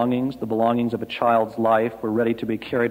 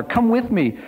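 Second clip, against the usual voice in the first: put-on high child's pleading call; pitched-up little-boy plea; 12 semitones higher, 4 dB louder than usual. A narrator's reading. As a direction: call to a brother, high and urgent. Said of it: "Richard called down to him".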